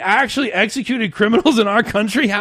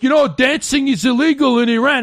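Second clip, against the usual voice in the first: mock-indignant whine, higher and louder; mocking angry voice; 8 semitones higher, 7 dB louder than usual. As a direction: parody a prissy outraged scold. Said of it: "gets all angry, and he's like".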